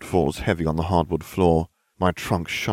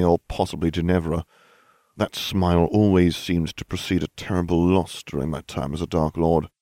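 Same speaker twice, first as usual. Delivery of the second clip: plain reading voice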